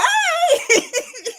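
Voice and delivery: high giggling laugh. Real, not acted. Laughing after "Hey!.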